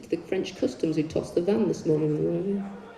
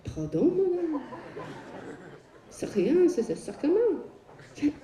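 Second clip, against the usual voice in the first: high, soft French protest; French officer; 9 semitones higher, 5 dB quieter, slower than usual. Acted. French customs man answering the handbag owner, French accent. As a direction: play a meek, high-voiced Frenchman. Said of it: "Frenchman going".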